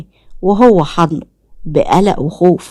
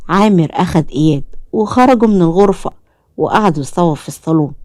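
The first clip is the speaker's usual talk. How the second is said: ordinary delivery, nothing marked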